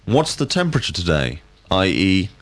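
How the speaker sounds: ordinary talk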